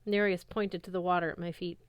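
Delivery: ordinary delivery, nothing marked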